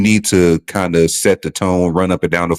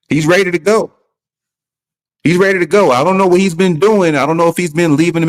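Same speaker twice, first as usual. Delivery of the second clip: excited; 9 semitones higher than usual. Real, not acted. Excited about Luka's new look.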